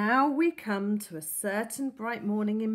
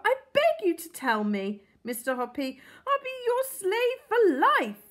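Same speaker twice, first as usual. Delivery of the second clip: begging; 10 semitones higher, 7 dB louder than usual. A narrator's reading. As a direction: plead with all your heart, high and loud. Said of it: "cried Missus Silver".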